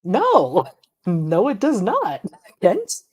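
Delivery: can't believe what he just said; exasperated correction climbing in pitch; incredulous no. Real, not acted. Reacting to wrong claim.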